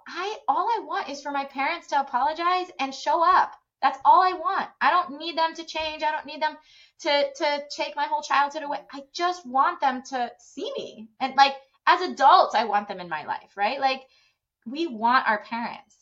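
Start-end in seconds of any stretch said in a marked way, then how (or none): 0.1-11.1 higher, insistent voiced plea; earnest plea. Adult child wanting parents to show up, earnest plea. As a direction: imitate a grown child pleading.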